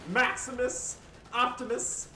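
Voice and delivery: high, slow, loud mock epic announcer; over-the-top announcer voice; hammy announcer. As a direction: boom it out like an arena herald. Hype on tread shot.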